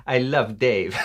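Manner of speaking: playful put-on Italian English; hammy Italian accent at natural pitch; mock Italian accent. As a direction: play an Italian woman recounting a romance. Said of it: "is that a French accent? No, Italian".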